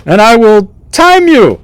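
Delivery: high-pitched court jester master of ceremonies; theatrical jester, far above normal pitch; jester announcing contest. Acted. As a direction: announce the rules in a piping jester voice.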